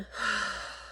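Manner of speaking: long audible sigh out; demonstrated exhale. Shown as a demonstration. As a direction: breathe out audibly to show the release. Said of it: "when you breathe out".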